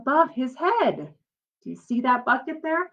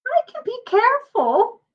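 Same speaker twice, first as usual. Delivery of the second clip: put-on much higher small-child voice; little sister; 12 semitones higher than usual. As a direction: squeak it like a small girl pleading. Little sister pleads her case.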